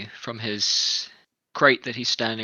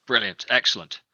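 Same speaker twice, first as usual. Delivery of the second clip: usual speaking voice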